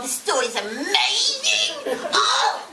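slow, laughing gush; giggling. Real, not acted. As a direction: laugh through the words in delight. Giggling excitement.

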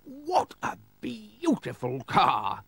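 high, very slow, drawn-out marvelling; delighted exclamation. Acted. Sir Marmaduke on seeing Gumdrop, high drawn-out exclamation. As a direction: exclaim it as an awestruck lord, stretching the words. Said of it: "he exclaimed".

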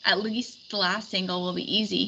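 usual speaking voice

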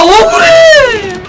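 frustrated gamer's whiny cry; whiny wail. Real, not acted. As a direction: wail it like you were robbed. Losing a game round, frustrated.

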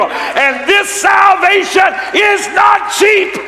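high, loud, thundering sermon; fiery pulpit preaching, voice climbing; full-force preaching. Acted. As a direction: preach it hard to the congregation.

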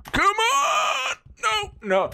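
high cracking scream of frustration; frustrated yell. Real, not acted. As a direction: yell it out in despair. Raging at failed jump.

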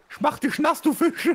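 high, loud gleeful taunt; excited yelling. Real, not acted. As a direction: shout it at the fish with glee. Yelling at fish in game.

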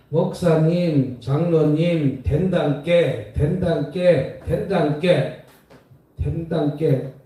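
slow, drawn-out Jeolla dialect; Jeolla accent. Retold or imitated. Pastor imitating Jeolla churchgoers, slow Jeolla accent. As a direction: drawl it like a Jeolla elder. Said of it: "저 전라도 갔더니".

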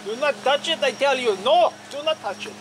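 loud, stern warning shout. Real, not acted. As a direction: yell it as a firm warning. Yelling warning to not touch.

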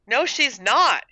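high, loud contradiction; crying back. A narrator's reading. Harriet contradicts Gertrude about Daisy, crying back. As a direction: shout down your sister. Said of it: "Harriet cried back".

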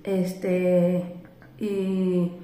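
usual speaking voice

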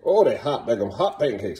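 usual speaking voice

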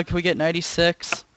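normal talking voice

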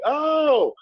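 very high, drawn-out Southern "oh"; Southern accent. Retold or imitated. Locals reacting to his hometown, imitated Southern accent. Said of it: "we lost our Southern accents early".

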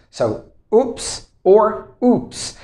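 normal talking voice

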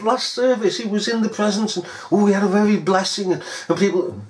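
high, breathless mock gushing; mock-excited, high and rushed; mocking churchgoer. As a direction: parody a giddy revivalist. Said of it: "You're just mocking them".

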